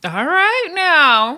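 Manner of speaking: slow, high mock sideline reaction. Vlogger imitating J Lo on the sideline, slow and high.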